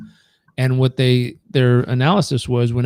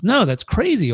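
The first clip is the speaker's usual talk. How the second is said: incredulous refusal; 10 semitones higher than usual. Acted. Boy rejects old man's advice.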